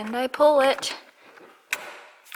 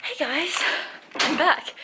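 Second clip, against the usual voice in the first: usual speaking voice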